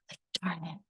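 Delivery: hushed whisper, under the breath; a quiet whispered darn it; whispered self-reproach. Retold or imitated. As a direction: whisper it to yourself, annoyed. Self-reproach after hurting daughter.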